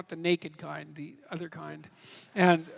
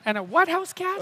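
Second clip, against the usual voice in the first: surprised question; 10 semitones higher, 5 dB louder than usual. Real, not acted. Didn't catch the odd word.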